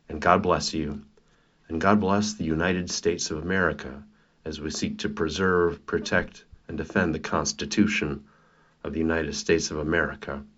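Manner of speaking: solemn blessing, measured and steady; benediction. Acted. Preacher blessing viewers and the nation, solemn and steady. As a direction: bless the listeners with quiet conviction.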